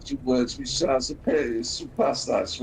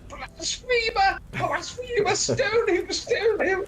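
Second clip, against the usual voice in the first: high, hammed-up cry of \"Blasphemer!\"; mock accusing shout; slower than usual. Pointing at the man in the film, hammed-up high accusing cry. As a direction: shriek the accusation like a comic zealot. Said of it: "points at him and says".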